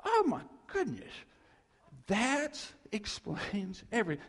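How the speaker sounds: retold eureka moment; amazed discovery. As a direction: cry out like the lights came on. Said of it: "it's like".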